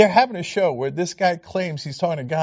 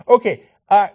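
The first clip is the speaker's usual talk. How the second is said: ordinary talk, nothing marked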